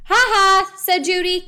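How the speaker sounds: high, loud triumphant "ha ha"; teasing, high-pitched triumph; gloating. A narrator's reading. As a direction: crow over the catch. Judy gloats over catching newt.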